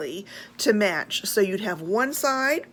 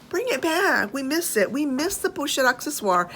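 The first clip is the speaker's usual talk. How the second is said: higher pleading plea; much higher plaintive plea; 6 semitones higher than usual. Real, not acted. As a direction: beg the brand to bring it back. Pleading to the brand.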